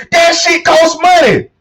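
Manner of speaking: drawn-out shout, preacher style; emphatic shout. Real, not acted. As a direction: hammer home the point about cost. Ranting about daily costs.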